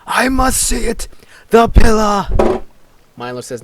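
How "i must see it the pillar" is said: high, very loud frantic yell; full-force, high-pitched shout. Acted. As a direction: belt it out at the top of your voice. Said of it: "[name] is yelling".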